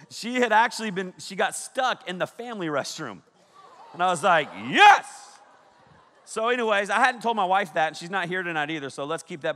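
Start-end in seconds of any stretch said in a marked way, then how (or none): 4.7-5.1 loud, high yes; shouting yes. Retold or imitated. Dad shouting back to his stuck daughter, loud and high. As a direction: holler back to your kid.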